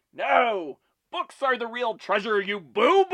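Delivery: high, loud cartoon villain yell; Skeletor yelling. Villain on his throne berating Beastman, hammed-up yell. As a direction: scold a henchman in mock villain fury. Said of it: "he's yelling at Beastman".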